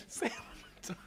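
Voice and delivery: high, breathless laughing plea; helpless squeaky giggling, words barely getting out; laughing to tears. Real, not acted. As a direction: beg weakly through real crying laughter. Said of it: "you got me crying in my glasses".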